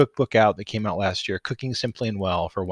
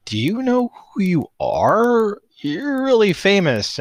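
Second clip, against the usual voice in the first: high, loud, drawn-out disbelief; amazed at a humble celebrity, slow and emphatic; incredulous; 12 semitones higher, 7 dB louder, slower than usual. Retold or imitated. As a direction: stretch it out in high-pitched mock astonishment. Acts out own amazed reaction to guest.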